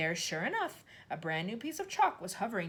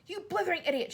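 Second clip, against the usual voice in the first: higher, louder insult; shouted; 12 semitones higher, 5 dB louder than usual. A narrator's reading. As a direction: spit the insult out. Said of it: "shouted Trunchbull".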